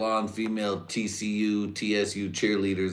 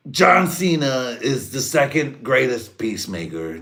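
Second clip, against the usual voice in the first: higher, louder, slow brag; mock Trump; 7 semitones higher, 8 dB louder than usual. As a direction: savour the boast. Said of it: "I can just hear".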